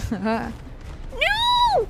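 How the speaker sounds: high, shrill no; high dismayed cry. Real, not acted. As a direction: wail in dismay. Dismay during the fight.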